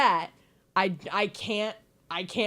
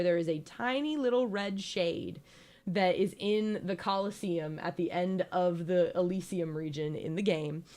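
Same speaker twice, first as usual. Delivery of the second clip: ordinary delivery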